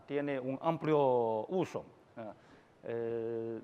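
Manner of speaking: normal talking voice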